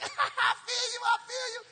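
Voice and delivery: high, loud laughing brush-off. Retold or imitated. Preacher laughing off the barista's refusal. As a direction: laugh like he must be joking. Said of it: "I started laughing".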